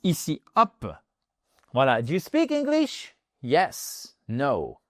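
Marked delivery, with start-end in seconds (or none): 2.0-3.2 much higher, pitch climbing on speak; rising question tune. Shown as a demonstration. Teacher modelling a yes-no question, rising tune. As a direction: lift the tune up on "speak English". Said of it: "On remonte l'intonation".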